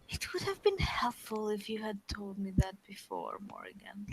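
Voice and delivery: hushed, breathy aside; whispering. Real, not acted. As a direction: whisper it under your breath. Grumbling at the whispering Morrigan.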